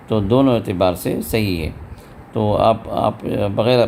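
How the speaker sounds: ordinary talk, nothing marked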